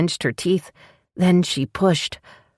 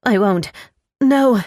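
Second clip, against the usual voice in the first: 1 high distressed refusal; 7 semitones higher, 5 dB louder, slower than usual. Acted. Tanya in labour refusing.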